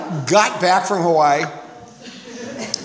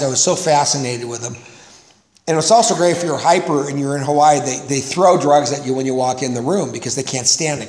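ordinary talk

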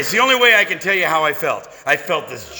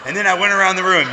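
normal talking voice

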